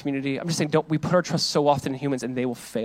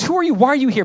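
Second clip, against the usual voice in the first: mocking brother; 8 semitones higher, 3 dB louder than usual. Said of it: "how Eliab goes".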